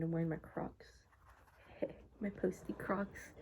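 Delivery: ordinary delivery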